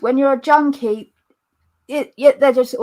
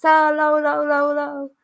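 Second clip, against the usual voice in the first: put-on Jamaican accent. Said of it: "they do the Jamaican accent".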